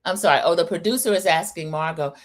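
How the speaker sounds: ordinary talk, nothing marked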